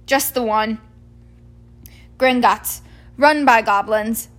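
booming cheerful giant ready to go; Hagrid the giant. Acted. Hagrid voiced in story.

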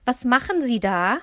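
laut und hoch gerufen; rufend. A narrator's reading. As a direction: ruf es empört quer durchs Zimmer. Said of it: "rief Ma ihm entgegen". (Ma ruft dem Fremden im Wohnzimmer laut entgegen)

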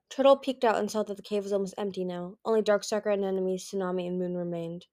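ordinary delivery, nothing marked

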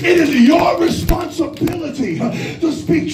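fired-up preacher working the congregation; full-force preaching. Real, not acted. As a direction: preach it hard in gasping, punched-out phrases.

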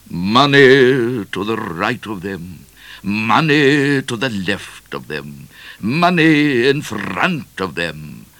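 slow, declaimed verse with swelling line openings; thunderous recitation. A narrator's reading. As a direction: declaim it grandly, drawing out every line. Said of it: "he thunderously recited".

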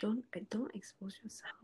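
hushed whisper with no voice. Real, not acted. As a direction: whisper it so nobody hears. Whispered aside.

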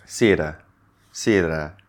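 theater said as "siater", th replaced by s. Shown as a demonstration. Teacher demos learner's error: theater with s instead of th. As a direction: say theater with an s at the start. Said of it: "Let's say you say".

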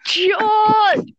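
loud, high call of George. Acted. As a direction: yell the name across the room. Calling out a name in scene.

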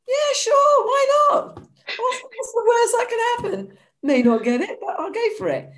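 high, loud gleeful cry, laughing near the end; excited shout. Retold or imitated. To her cousin about the BA job, a high gleeful shout. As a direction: belt out a reckless yes.

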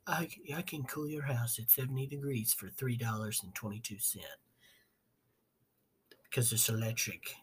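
lightly put-on, hushed tech boss boast; a soft, understated mock-bigshot voice; Elon Musk. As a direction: quietly play at being the boastful inventor. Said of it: "I don't know why I'm talking like that".

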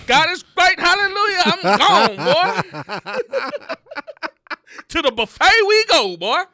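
hammed-up eager exit from church, straight to the buffet; mock churchgoer jolting awake at the end of service; excited churchgoer. Sleepy churchgoer waking for buffet.

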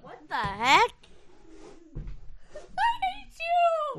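being tickled. Real, not acted. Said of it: "She's tickling me".

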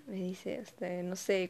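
usual speaking voice

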